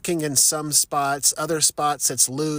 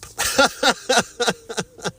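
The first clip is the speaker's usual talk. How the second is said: a high laugh falling away; 7 semitones higher than usual. Real, not acted. Taking a lap to cool off, high laugh. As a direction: laugh at yourself for quitting.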